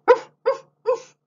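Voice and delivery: high, loud playful barking oof; dad barking oof. Acted. Dad on hands and knees playing dog, high loud barking. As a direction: bark like a silly dog. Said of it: "The dad's down on his hands and knees saying".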